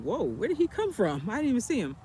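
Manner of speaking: mock startled whoa at the man out of nowhere; retold surprise. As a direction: caught off guard by a stranger appearing. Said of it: "And I'm like".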